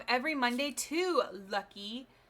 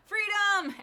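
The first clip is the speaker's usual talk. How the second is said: loud high cry of freedom; excited shout; 10 semitones higher, 6 dB louder than usual. Acted. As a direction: shout it out triumphantly. Shouts after ad break.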